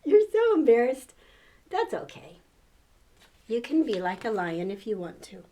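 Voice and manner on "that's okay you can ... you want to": ordinary delivery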